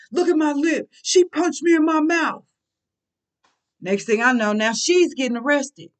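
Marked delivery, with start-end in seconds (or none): 0.1-2.4 mocking whine. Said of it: "now he complaining".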